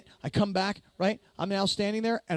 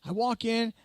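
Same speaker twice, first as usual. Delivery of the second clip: ordinary delivery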